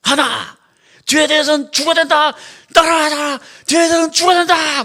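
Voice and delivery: shouted military chant; loud gung-ho soldier. Pretend. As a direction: chant orders like an army drill. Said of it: "군대 오제야, 군대".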